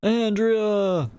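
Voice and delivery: high, loud drawn-out holler; mock yell. Joking about the name Andrea, hammed-up high yell.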